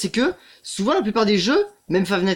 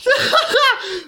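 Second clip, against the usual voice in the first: high, shrieking nervous laugh; startled laugh; 3 dB louder than usual. Real, not acted. Gamer caught by Foxy, high nervous laugh. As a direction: burst out laughing after the scare.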